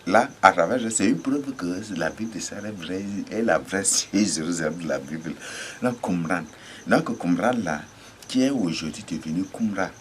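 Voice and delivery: normal talking voice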